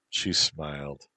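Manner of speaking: ordinary delivery, nothing marked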